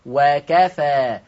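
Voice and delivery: ordinary talk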